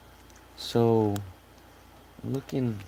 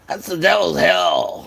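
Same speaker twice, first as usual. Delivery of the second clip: mock dramatic; 7 semitones higher, 8 dB louder than usual.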